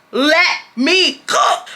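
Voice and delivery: slow, high, stretched sass; drawn-out sassy. Retold or imitated. Telling the rude customer off, slow and sassy. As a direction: stretch it out, savouring the moment.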